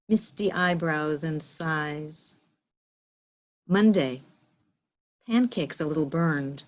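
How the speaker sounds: slow, spacious poetry recital; slow reading, day names set apart like headings; poem reading. Acted. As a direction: let each image stand alone between long pauses. Reads own poem aloud.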